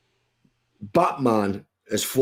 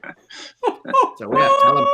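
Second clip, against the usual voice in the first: laughing catchphrase; 6 dB louder, slower than usual. Real, not acted.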